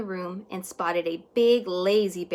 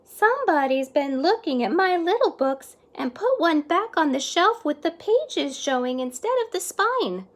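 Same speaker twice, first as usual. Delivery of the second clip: higher, louder motherly voice; a fussy mother librarian, pitched up; higher-voiced mama librarian; 8 semitones higher, 4 dB louder than usual. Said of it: "Then Mama Librarian said".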